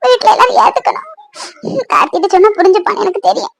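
high, sobbing plea to God; crying, pleading prayer; tearful prayer. Acted. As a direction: confess and beg through tears. Weeping confession in prayer.

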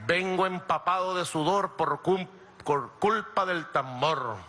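slow, drawn-out rhyming line; playful verse recital. Shown as a demonstration. Speaker showing the crowd his talk comes out in verse, playful slow recital. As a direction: stretch out the rhyme on "tambor". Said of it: "converso me sale en verso".

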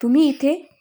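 shocked. A narrator's reading. Shocked to see him there.

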